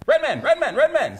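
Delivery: high, loud calling-out impression. Imitates [name] calling at keyboard.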